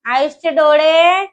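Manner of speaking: slow sing-song with stretched final words. Real, not acted. Mother quizzing her toddler on body parts, sing-song prompting.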